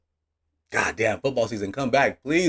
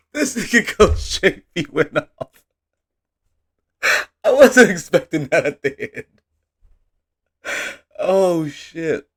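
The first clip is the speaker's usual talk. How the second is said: still laughing; 8 semitones higher, 8 dB louder, slower than usual. Real, not acted. Laughing reaction to clip.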